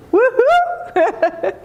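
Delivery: high, laughing cheer; excited woohoo. Retold or imitated. Cheering the record, high and laughing. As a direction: whoop for him, laughing.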